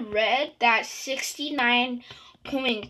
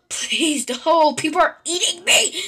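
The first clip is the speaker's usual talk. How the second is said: loud, rising plea; panicked protest; 5 semitones higher, 6 dB louder than usual. Real, not acted. Player whose people are eating me in game, panicked protest. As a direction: cry out higher and louder as it goes.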